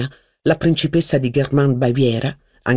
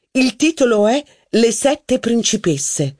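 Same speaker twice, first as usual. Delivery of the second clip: higher, slow scornful title; mocking duchess; 8 semitones higher than usual. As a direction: drop the title with disdain. Duchess voiced in reading.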